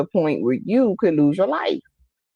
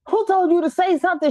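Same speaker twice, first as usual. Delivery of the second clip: high, fast indignant comeback; 12 semitones higher, faster than usual. Retold or imitated. Mimicking her own reply to the aunt, high and offended. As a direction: snap back offended at the aunt. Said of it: "I'm like".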